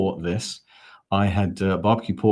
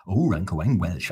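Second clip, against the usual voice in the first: sing-song mock Welsh; put-on Welsh with swooping pitch; Welsh accent. Shown as a demonstration. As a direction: try out a lilting Welsh accent. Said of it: "Oh, that's Welsh".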